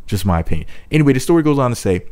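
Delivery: ordinary talk, nothing marked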